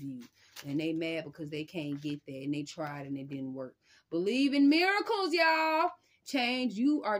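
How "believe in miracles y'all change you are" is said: high, loud burst of hype; excited shout. Real, not acted. Reader shouting encouragement to her viewers, excited and loud. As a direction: shout it out like a revival cheer. Hypes viewers about coming miracles.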